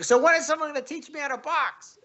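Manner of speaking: a guy in a goofy costume, faking he can't box, squeaky and high; goofy high mock voice. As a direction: play the clueless clown asking to learn boxing. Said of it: "he says, like".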